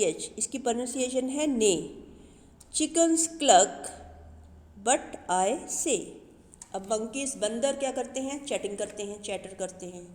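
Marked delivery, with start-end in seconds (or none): 2.7-6.0 put-on loud, measured recital of a children's poem; poem recitation. Teacher reciting the poem to her young pupils, loud and measured. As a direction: recite each animal line clearly for the class.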